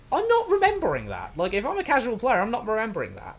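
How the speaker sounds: high-pitched exasperated objection; incredulous protest. Pretend. Playing the casual player who forgot, incredulous protest. Protesting a plot callback hours later.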